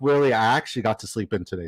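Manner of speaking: normal talking voice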